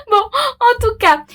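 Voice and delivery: high, giggly lift out of a laugh. Real, not acted. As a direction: squeak it out through the laugh, drawn out. Laughs just before signing off.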